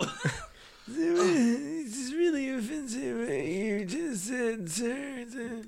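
put-on high, soft, slow old-timer; put-on elderly voice; old man voice. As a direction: feebly object like an old man. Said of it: "the, the voice".

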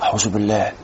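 very low, hushed mock dismay; mock horror. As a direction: drop low and soft, feigning shock. Acting out a shocked objector. (استنكار تمثيلي بصوت واطي)

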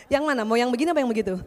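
ordinary talk, nothing marked

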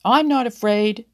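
loud defiant shout. A narrator's reading. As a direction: yell it back at the squirrel. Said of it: "he shouted".

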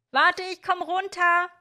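high, slow, sustained call. A narrator's reading. Miorin to Hiro from the window, high carrying call. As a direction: sing it out to carry to someone below.

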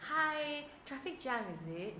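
high, drawn-out sweet hello; fake smiling greeting. Host greets a late client, falsely sweet. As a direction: greet through a forced smile. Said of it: "I will smile and be like".

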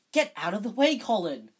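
high, loud angry order. A narrator's reading. Harry shoving off the pestering Colin, loud and angry. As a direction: snap him out of the way. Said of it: "said Harry angrily".

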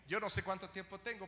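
ordinary delivery

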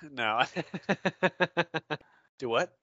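ordinary delivery

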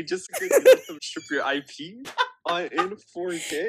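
laughing through a teasing question. Real, not acted. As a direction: tease her and laugh as you ask.

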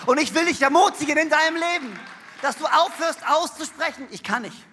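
loud, driving sermon voice; rising to a loud, fervent appeal; preaching at full force. Acted. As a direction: preach it out to the whole hall.

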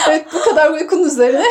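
laughing. Real, not acted. Laughing at the end of topic.

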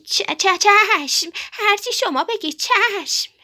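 high, stammering yes-sir from cowed little birds; timid stuttering birds. A narrator's reading.

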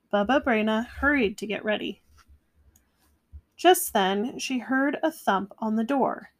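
plain reading voice

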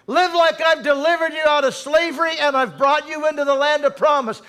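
loud, high, commanding call to rise; God calling out. Acted. Preacher voicing God urging believers to rise, loud and high. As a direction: thunder God's command to get up and live. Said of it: "God says".

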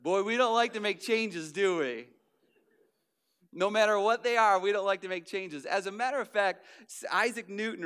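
a pastor building to full force; preaching. Acted. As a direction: preach it out to the room.

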